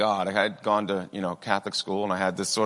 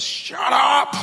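sudden furious yell, much higher and louder; angry shout; 6 dB louder than usual. Retold or imitated. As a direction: bark it at the boy, furious. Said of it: "he goes".

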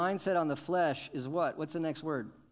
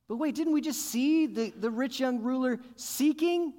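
much higher, louder mock objection; objecting; 10 semitones higher, 6 dB louder than usual. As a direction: play the puzzled listener. Preacher voices objection.

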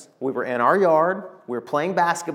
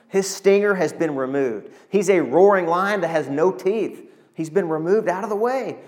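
normal talking voice

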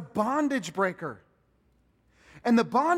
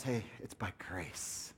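whisper; 12 semitones lower, 12 dB quieter, slower than usual. Retold or imitated. Paul's message voiced softly.

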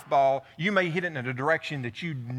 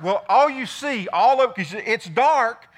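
ordinary delivery

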